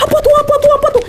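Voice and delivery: high, repeated frantic cry; panicked yelling. Acted. Arif running downhill, high panicked yelling. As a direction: cry it out over and over in alarm. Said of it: "si Arif malah teriak-teriak".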